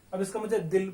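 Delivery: normal talking voice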